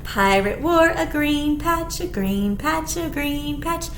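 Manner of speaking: ordinary delivery, nothing marked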